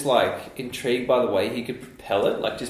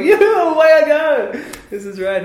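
excited; 9 dB louder than usual. Retold or imitated. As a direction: relive the thrill of rolling off. Retold own excited thought.

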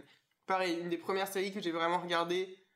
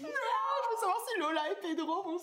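put-on whiny kid; 11 semitones higher than usual. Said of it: "Je fais".

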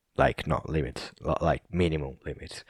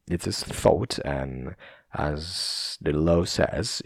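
ordinary talk, nothing marked

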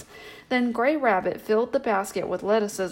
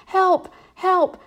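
much higher and louder, yelling for help; frantic shout; 10 semitones higher, 7 dB louder, slower than usual. A narrator's reading. Mole, waistcoat pulled over his head, shouting for help. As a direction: cry out as if robbed blind. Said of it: "shouted Mole".

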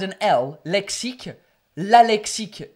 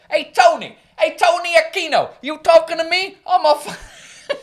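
Hey, Tony, You talking to me? much higher and louder; hammed-up movie gangster from New York; loud playful Taxi Driver impression; mock New York tough guy; 10 semitones higher, 7 dB louder than usual. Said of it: "belong in a T V show in America".

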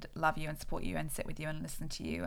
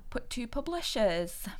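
slow, higher northern English accent; 6 semitones higher, slower than usual. Real, not acted. Author feeling vulnerable, going northern English accent. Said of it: "you had to say that in a weird accent".

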